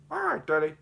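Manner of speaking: put-on high-pitched kid reply; small high cartoon kid; kid character voice. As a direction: put on a high child's voice for Daddy. Voicing Jeffy answering his dad.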